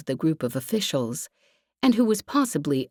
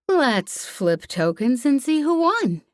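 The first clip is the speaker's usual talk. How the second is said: slow, slightly slurred and higher, playing tipsy; 8 semitones higher, slower than usual. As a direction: drag the words as if a bit drunk. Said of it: "letting her words slur just a little".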